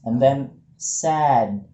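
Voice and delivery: "sad" said very slowly with open vowel, spaced out; slow teaching demo; slow model. Shown as a demonstration. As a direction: stretch "sad" out for the student. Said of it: "jadi sebenarnya itu sad".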